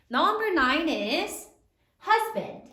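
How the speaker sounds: ordinary talk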